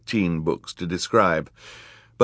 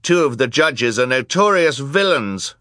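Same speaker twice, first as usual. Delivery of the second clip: put-on raised, higher boy's voice; announcing over gasps; 7 semitones higher, 5 dB louder than usual. A narrator's reading. Klaus telling the gasping crowd about the judges, loud announcement. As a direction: proclaim it above a noisy crowd. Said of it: "Klaus announced over the gasps of the crowd".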